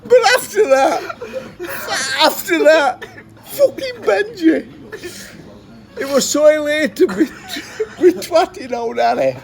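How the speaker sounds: crying with laughter as you talk; real tearful laughter through the words; laughing to tears. Real, not acted.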